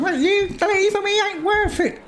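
very high hammed-up soap line; mock a weepy EastEnders actor; hammed-up high soap drama; mock soap crier. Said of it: "somebody starts".

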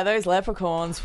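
normal talking voice

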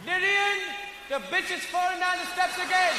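loud, high, annoyed yelling; angry shout. Retold or imitated. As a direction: bellow across the house. Husband yells at commotion.

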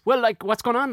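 high baffled challenge; confused protest. Retold or imitated. Retold confused reply to cop.

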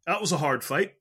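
normal talking voice

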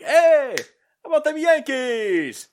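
loud, high, drawn-out holler; yelling at somebody. Shown as a demonstration. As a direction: call it out obnoxiously across the way. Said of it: "yell at somebody and be like".

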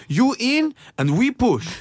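high, slow broken English order; the carnival worker orders you in, slowly. Retold or imitated. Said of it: "en marknad där ingen kunde svenska".

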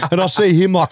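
ordinary talk

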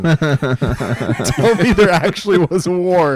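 slow, high, laughing through words; laughing disbelief. Real, not acted. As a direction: giggle out the question in disbelief. Laughing disbelief at wallaby wars.

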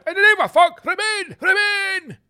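hammed-up scaremonger bellowing Remain; mock campaign shout. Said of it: "come out and say".

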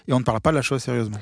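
normal talking voice

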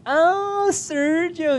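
high drawn-out "Ah" of mock realization; mock dawning recognition. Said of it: "Y luego".

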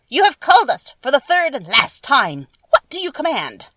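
loud, high, declaiming creature leader; monkey leader's voice. A narrator's reading. Winged monkey leader reporting to the witch, loud high proclamation. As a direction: proclaim it loudly, pitched well up.

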